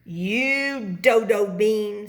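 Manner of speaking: slow, loud, sneering snap; snapping. A narrator's reading. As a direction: drag out the insult at him. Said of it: "snapped Snap Bean".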